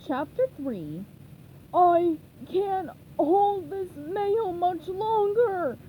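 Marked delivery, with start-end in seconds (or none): 1.7-5.8 high, slow, straining near-cry; gasping strain. A narrator's reading. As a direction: struggle to hold on, crying out. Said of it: "Patrick gasped".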